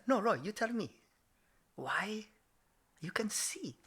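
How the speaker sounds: put-on patient quizzing in dad's Japanese accent. Dad repeats question to son, soft Japanese accent. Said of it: "I have to do it in his accent".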